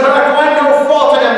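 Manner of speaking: high, fast, loud objection; shouted protest. A narrator's reading. Pilate argues with the crowd, shouting in protest. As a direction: protest urgently over the mob.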